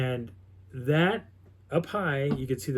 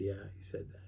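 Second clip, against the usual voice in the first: low, much softer reply; quiet confirmation; 5 semitones lower, 15 dB quieter than usual. Retold or imitated. Camper confirming his friend's earlier words, quiet confirmation. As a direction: confirm it under the voice. Retold confirmation answering the question.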